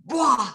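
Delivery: explosive "bwah" of a door; sudden loud mouth-made bang; imitated door bang. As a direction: burst it out like a door flying open. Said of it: "like, with a bang".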